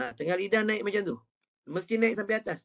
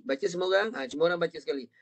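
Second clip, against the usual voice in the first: usual speaking voice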